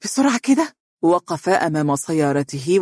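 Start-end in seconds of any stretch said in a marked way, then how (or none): 0.0-0.8 high startled question; fearful surprise. A narrator's reading. Wife hearing of the night raid, high and frightened. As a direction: catch your breath in alarm. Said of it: "بخوف".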